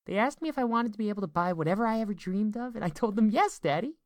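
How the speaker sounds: put-on high, loud child voice; excited little girl. A narrator's reading. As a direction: gush to Dad as a small daughter. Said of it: "she sounded excited".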